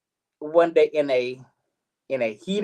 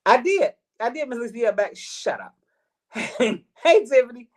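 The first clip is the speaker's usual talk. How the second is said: excited greeting; 10 semitones higher than usual. Real, not acted. Greets chat viewers, high voice.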